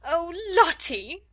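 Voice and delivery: high, loud, drawn-out distraught cry; screamed. A narrator's reading. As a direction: cry out in desperation to a wailing child. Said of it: "screamed Miss Amelia".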